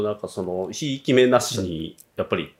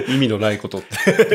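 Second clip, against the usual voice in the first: amused words breaking into laughter; laughing. Real, not acted. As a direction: repeat it through a laugh. Amused reaction to host's remark.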